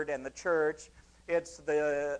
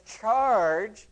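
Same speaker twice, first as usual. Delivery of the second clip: battle cry; 3 semitones higher, 6 dB louder than usual. Pretend. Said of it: "a church that says".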